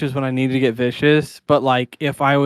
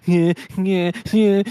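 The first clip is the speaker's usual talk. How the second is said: whiny, higher-pitched fake bawling; exaggerated imitation of ugly crying; mock ugly cry; 5 semitones higher than usual. Joking about wanting to ugly cry, fake bawling. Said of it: "ugly cries that was like".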